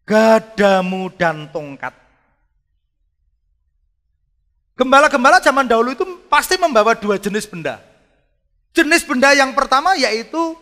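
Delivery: loud, high-pitched pulpit voice; preaching at full force. Acted. Preacher booming to his congregation in full force. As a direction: proclaim it to the congregation. Preacher opens the point loudly.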